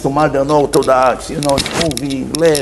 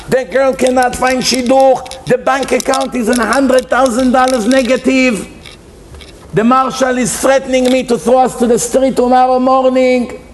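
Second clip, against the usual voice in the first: high, insistent litany of woes; anguished lament; 7 semitones higher than usual. Acted. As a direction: pour out each trouble on one high, pleading note.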